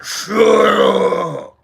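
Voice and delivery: loud snapped command; angry shout. Retold or imitated. Chiefs fans yelling at the Chargers fan, angry and loud. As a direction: tell the cheering fan to shut up, loud. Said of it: "stood up and were like".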